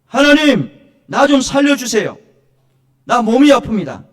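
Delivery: loud, high plea in prayer; crying out to God. Acted. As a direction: cry out for help like a desperate supplicant. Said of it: "부르짖으라는 거예요".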